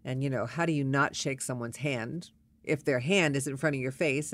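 ordinary delivery

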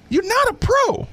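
very high, emphatic verdict; emphatic repeat. Real, not acted. Host repeats his verdict on the player, high-pitched and emphatic. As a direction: pitch the whole line way up for emphasis.

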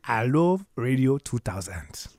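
slow, breathy Nigerian accent. Shown as a demonstration.

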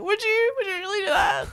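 high, mock-tearful hopeful voice; tearful gratitude. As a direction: play the touched girlfriend, almost crying. Voices her grateful, hopeful reply.

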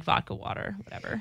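whispered, careless aside; whispered shrug. Retold or imitated. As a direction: throw it away in a whisper. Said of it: "then I'm like".

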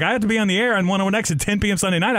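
high, fast, rushed protest piling on detail; panicked rush. Retold or imitated. Telling cellmate about his radio shift, fast panicked rush. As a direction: blurt it all out in a rush. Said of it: "I was like".

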